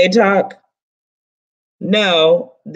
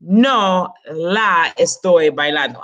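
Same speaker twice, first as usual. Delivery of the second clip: "No la estoy bailando" said slowly, stress on "No"; slow model sentence; slower than usual. Shown as a demonstration. Teacher models the Spanish sentence for students, slow and deliberate. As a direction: spell out the model sentence, leading with "No". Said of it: "no must go at the beginning".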